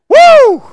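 very high, piercingly loud "woo"; exultant sustained holler; praise whoop. Real, not acted. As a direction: whoop at full voice.

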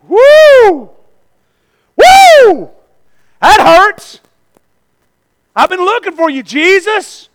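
high, loud whooping outburst; acted shout of a desperate searcher; crying out. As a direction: holler as if stung, then call out.

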